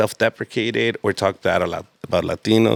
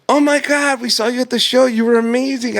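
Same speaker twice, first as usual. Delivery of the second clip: high, loud imitation of excited fans; mock fan squeal of praise; gushing fans; 13 semitones higher, 4 dB louder than usual. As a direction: gush like a starstruck couple, pitched way up. Voicing fans who recognise him.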